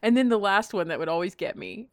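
ordinary talk

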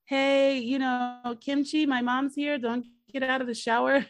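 high, loud call from the next room; yelling. Retold or imitated. Son yelling to Kim Chi that his mom is here, loud call. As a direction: shout it through the apartment. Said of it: "but he's, like, yelling".

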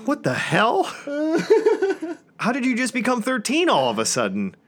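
high-pitched incredulous dad reaction; baffled outburst. Retold or imitated. Dad reacting to daughter's tantrum, incredulous. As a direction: squeak it out in disbelief.

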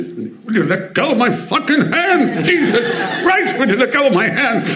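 mock outrage in a high put-on old voice; Walter Matthau impression. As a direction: ham up a cranky old star complaining. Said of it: "he'd say".

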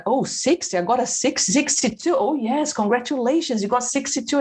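high, amazed voice on the marks; surprised delight. Retold or imitated. Student learning sixty is good: high amazed surprise. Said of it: "And I was like".

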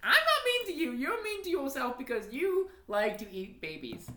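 high-pitched pretend protest; mock-indignant. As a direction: fire back in a playful squabble. Mock squabble between co-hosts.

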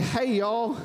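put-on clumsy American accent greeting; bad American accent. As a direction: have a half-hearted go at American. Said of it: "I won't do an American accent".

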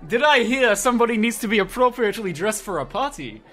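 hammed-up, bad Spanish accent; strong Spanish accent. Shopkeeper cuts in on customers in a hammed-up Spanish accent. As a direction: put on a vaguely European, would-be Spanish accent. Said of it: "you hear a very strong Spanish accent".

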